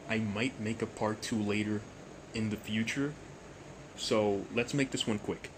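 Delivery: normal talking voice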